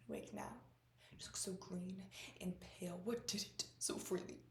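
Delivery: hushed theatrical whisper; Lady Macbeth. Acted. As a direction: drop to a soft, whispered taunt. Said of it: "my dream to play Lady Macbeth".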